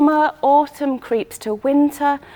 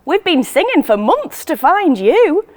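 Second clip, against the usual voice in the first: loud, higher yell toward the roof; 6 semitones higher, 6 dB louder than usual. A narrator's reading. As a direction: shout up to her friend. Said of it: "Betty yells up".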